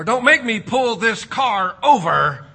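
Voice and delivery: loud, slow fed-up parent; mock parent threat. Parent threatening kids from the driver's seat, slow loud mock threat. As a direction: spell it out like an angry driver-dad.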